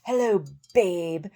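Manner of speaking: slow, drawn-out, put-on creep voice; mock sleazy greeting. Repeating the scammer's pickup line in a mock creep voice. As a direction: drawl it like a cheesy flirt. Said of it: "he was like".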